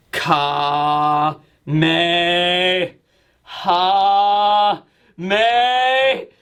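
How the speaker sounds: very slow, drawn-out chant rising to a shout; Kamehameha battle cry. Acted. Showing how to make flame clouds, slow building attack cry. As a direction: stretch each syllable, then burst on the last.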